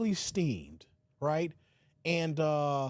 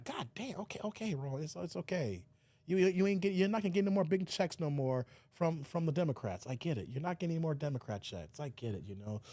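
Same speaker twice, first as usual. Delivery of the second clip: much softer, consoling; soothing Roland; 3 semitones lower, 5 dB quieter than usual. Retold or imitated. Host consoling Roland over lost checks, soft. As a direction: hush him like a child.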